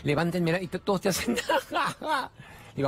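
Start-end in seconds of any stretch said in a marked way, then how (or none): 1.4-2.4 a sudden high burst of laughter. Real, not acted. Speaker laughing at the audience's answer, high burst.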